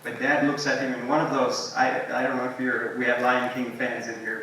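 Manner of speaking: normal talking voice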